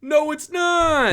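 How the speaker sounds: high, stretched-out protest; indignant protest. Real, not acted. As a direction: refuse loudly, dragging it out. Refusing to open up.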